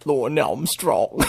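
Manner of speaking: put-on slow copy of "Lauren Armstrong" in his accent; imitating his accent. Mimicking the arrestee's accent, slow. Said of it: "He's got that accent".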